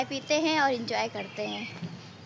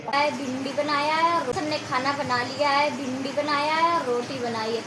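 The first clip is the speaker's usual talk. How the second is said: normal talking voice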